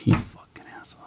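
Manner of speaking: whispered under the breath; muttered insult. Retold or imitated. Cop whispering an insult to the radio caller, under his breath. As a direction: hiss it so nobody hears.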